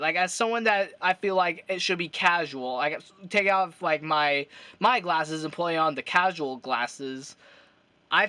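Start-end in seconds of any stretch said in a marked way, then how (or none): none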